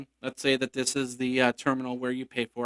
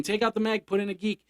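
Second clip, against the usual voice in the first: ordinary talk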